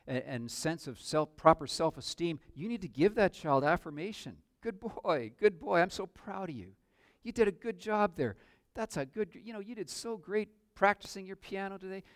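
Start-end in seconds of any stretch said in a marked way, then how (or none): 4.6-12.0 put-on high, lilting praise for a little boy; sing-song proud parent voice; doting praise. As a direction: gush over the child like a proud parent.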